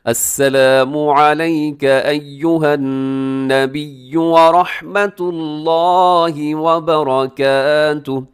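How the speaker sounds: slow, melodic chanted recitation; full recitation. Shown as a demonstration. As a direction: chant the whole phrase slowly. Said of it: "फिर सुनिएगा".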